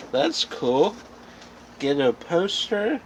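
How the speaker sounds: ordinary talk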